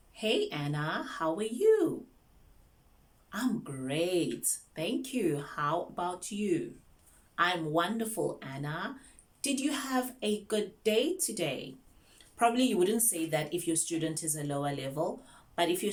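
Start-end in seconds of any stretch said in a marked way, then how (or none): 0.1-9.0 slow, measured, lilting teacher voice; slow teacher pace. Shown as a demonstration. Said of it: "do not speak fast". 9.4-11.7 high, slow, spaced-out question; slow teacher question. Shown as a demonstration. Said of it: "do not speak fast".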